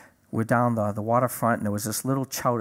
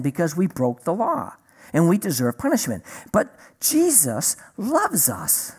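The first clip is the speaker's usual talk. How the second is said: simple, higher-pitched, sing-song teaching with big pauses; explaining to a four-year-old; 6 semitones higher than usual. Retold or imitated. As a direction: spell it out as if to a small child. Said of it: "that's how I'm explaining it to him, like a four-year-old".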